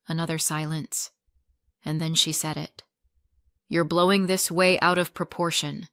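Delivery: ordinary delivery, nothing marked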